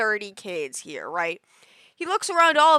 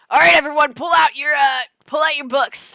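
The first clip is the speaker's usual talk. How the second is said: loud barking classroom call, higher; teacher shouting to class; 4 semitones higher, 8 dB louder than usual. Retold or imitated. Teacher shouting at third graders to pull out books. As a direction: call the class to attention at full volume.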